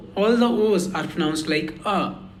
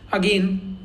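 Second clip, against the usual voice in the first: usual speaking voice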